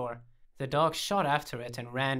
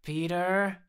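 higher, rising reluctant call; grudging; 5 semitones higher, 4 dB louder than usual. A narrator's reading. Owner giving in to the dog's name, grudging. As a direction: give in and call the name out loud. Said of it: "I said grudgingly".

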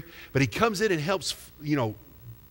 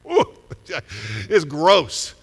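laughing, grossed out; disgusted laugh; 7 dB louder, much slower than usual. Real, not acted. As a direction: ooh with a laugh then louder. Reacting to cockroaches.

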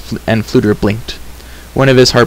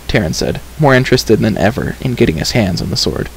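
ordinary delivery

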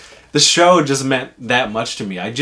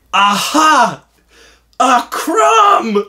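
much higher, loud triumphant aha; hammed-up gotcha; 8 dB louder, much slower than usual. Prankster uncle pointing at a crumb, hammed-up triumph. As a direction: crow over the crumb like a villain. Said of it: "And he goes".

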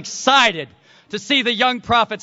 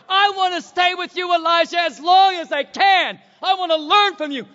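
Elisha pleading; 6 semitones higher than usual. Acted. Elisha pouring out his heart to Elijah, fervent shout. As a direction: plead as the devoted disciple. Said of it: "His heart".

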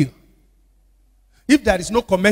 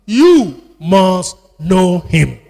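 each word of "You must know him" drawn out slowly and loudly; emphatic command; 8 dB louder, much slower than usual. Real, not acted. Preacher drives it home to congregation, slow loud emphasis.

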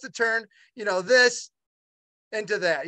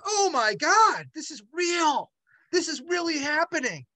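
catching his breath at sudden good news; amazed disbelief; 8 semitones higher than usual. Retold or imitated. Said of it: "I was like".

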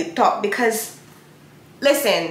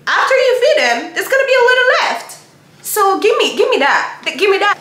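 much higher, louder, urgent begging; eager plea; 12 semitones higher, 6 dB louder than usual. Acted. The woman pleading with Jesus for scraps, high and eager. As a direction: reach out and beg for the leftovers.

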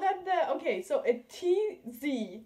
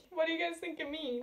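ordinary talk, nothing marked

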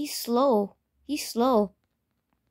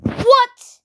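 raging gamer; 11 semitones higher, 12 dB louder than usual. Real, not acted.

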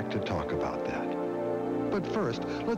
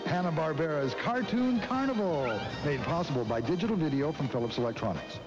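hard sell ad; 7 semitones higher than usual. Acted. Host announces the cartoon feature.

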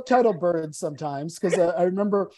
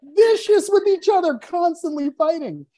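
laughing; 10 semitones higher, 4 dB louder than usual. Real, not acted. Laughing while describing vicious birds.

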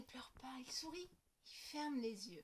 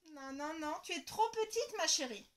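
gentle refusal; 5 semitones higher, 8 dB louder than usual. Acted.

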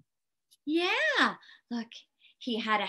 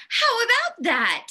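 put-on higher, louder cheer of children; children chorusing; 9 semitones higher, 6 dB louder than usual. A narrator's reading. Children all admiring the snowman's hat, bright loud chorus. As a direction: pitch it up like kids calling out. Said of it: "The children all said".

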